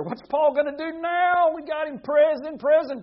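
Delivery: high, loud mock taunt; gloating captors. Paul's jailers crowing over their prisoner, high mocking shout. Voicing those who jailed Paul.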